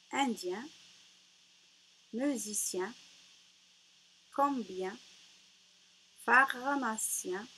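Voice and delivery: deliberate, loud word-by-word reading; careful classroom dictation with punched first syllables; rereading word list. Shown as a demonstration. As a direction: read each word out firmly, pausing between words. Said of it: "je relis les mots".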